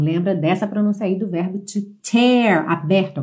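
usual speaking voice

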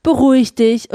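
normal talking voice

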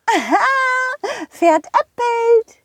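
squeaky high cartoon horse calling out. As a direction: play a cheerful high-pitched pony. Rosa teases. (hohe quietschige Pferdestimme)

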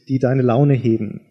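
ordinary delivery

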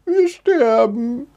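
high, slow, drawn-out squeak; squeaking mouse. A narrator's reading. Trapped mouse fearing death, squeaking wail. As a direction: wail it slowly as a terrified little mouse. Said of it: "piepste Mäuseline".

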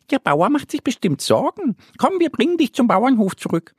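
high, quick little duck voice; bright high duckling, hurried and caring; little duck Annie. Acted. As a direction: chirp it out like a small eager duck.

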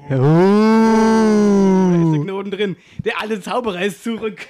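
slow, loud showman reveal; triumphant magician. Acted. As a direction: drag out the big reveal like a stage magician. The trick succeeds.

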